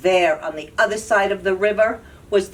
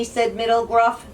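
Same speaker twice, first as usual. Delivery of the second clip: ordinary delivery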